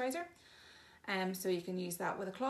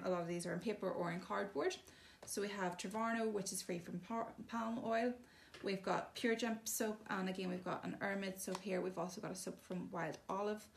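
ordinary delivery

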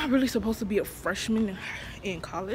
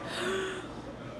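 sharp gasp; 11 semitones higher, 7 dB quieter than usual. Real, not acted. Sudden gasp, high pitch.

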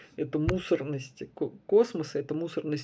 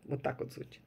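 usual speaking voice

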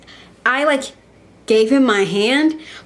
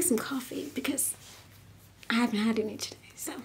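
whisper; 12 dB quieter than usual. Real, not acted. Hushed aside while fetching coffee.

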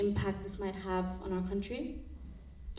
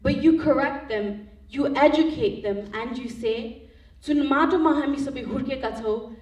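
higher, emphatic spoken-word delivery; slam poetry; 6 semitones higher, 9 dB louder than usual. Acted. Poet performing for an audience about brain drain, impassioned slam poetry. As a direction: perform it as a punchy slam poem with driven stresses.

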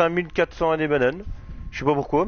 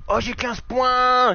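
surprised; 8 semitones higher, much slower than usual. Real, not acted. Surprised by reward.

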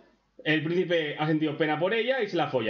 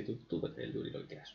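susurrado, casi sin voz; whispered aside; 8 semitones lower, 15 dB quieter than usual. Real, not acted. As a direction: susurra el comentario a la novia. Aside to girlfriend. (le susurra a su novia, burlón)

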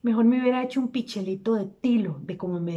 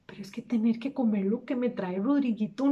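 ordinary talk

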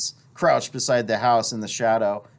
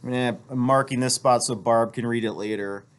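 plain reading voice

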